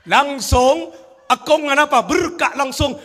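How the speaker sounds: retold shout of joy; excited shout. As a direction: call it out so the whole house hears. Grandfather shouting birth news.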